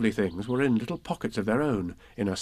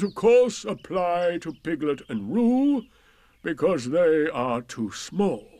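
pompous Eeyore; 5 semitones higher, 4 dB louder than usual. A narrator's reading. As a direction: make a laboured, self-important speech. Voices Eeyore, slow pompous speech.

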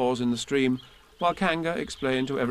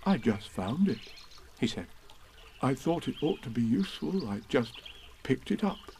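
Pooh; 5 dB quieter than usual. A narrator's reading. Pooh's voice.

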